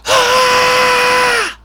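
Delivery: piercing, high, very loud scream; sudden full-blast mock scream into the mic; imitated scream. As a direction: scream as loud as you can, imitating her. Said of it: "screamed as loud as she could".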